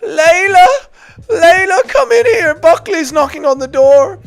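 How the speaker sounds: high, slow, put-on mocking voice; shrill-free high put-on voice of a fighter; mock Ian Garry. Mocking a fighter calling to his wife, high put-on voice.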